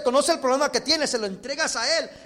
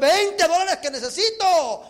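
loud, high, drawn-out exclamation; thrilled, stretched-out shout; delighted discovery; 5 semitones higher, 6 dB louder, slower than usual. Acted. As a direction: cry it out like finding a windfall. Acted discovery of hidden money.

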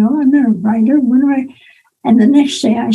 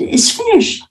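higher, lightly voiced editor; bright pitched-up Finished; imitating editor; 10 semitones higher than usual. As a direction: editor declaring the book done, voiced higher. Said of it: "She says".

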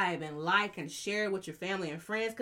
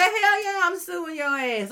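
laughing quote; 10 semitones higher, 7 dB louder than usual. Retold or imitated. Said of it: "She said".

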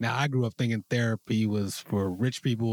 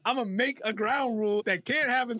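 mock stubborn self; 10 semitones higher than usual. Mocking his past self-sabotage.

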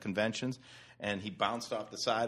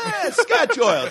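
much higher, slow, relishing the name; savoring; 14 dB louder, much slower than usual. Real, not acted. Friend announces the company name, slow and high. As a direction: roll the name out grandly.

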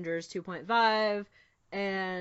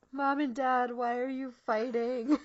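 put-on distressed kids pleading; 5 semitones higher, 5 dB louder than usual. Fan voicing heroes begging Mom and Dad, mock-distressed plea. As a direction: plead like a child caught between parents. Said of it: "I just want them all to be like".

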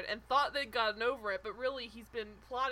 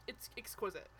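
ordinary delivery

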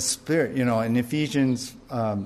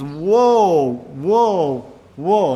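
dramatic eagle cry; 2 semitones higher, 5 dB louder, much slower than usual. A narrator's reading. Preacher voices the eagle crying woe over the earth, loud and drawn out. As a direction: cry the woes like a looming eagle.

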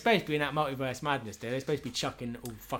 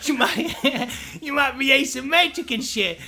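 much higher, much louder cracking up; laughing mock argument; 11 semitones higher, 9 dB louder, slower than usual. Patient and doctor bickering, much higher and laughing. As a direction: keep arguing through laughter.

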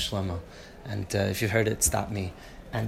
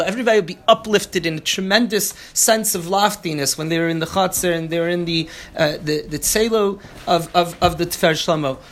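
louder, higher, driving lecture voice; fired-up preaching; 8 semitones higher, 8 dB louder than usual. Acted. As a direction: preach the rebbe's greatness with rising fervor. Fired-up Torah class.

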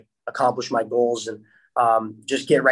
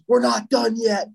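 high-pitched mock call down the bench; imitated protesting teammate; 9 semitones higher than usual. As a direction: act out a teammate's urgent objection, voice pitched up.

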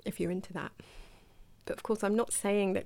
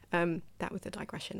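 ordinary talk, nothing marked

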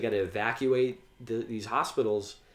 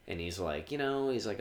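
ordinary delivery

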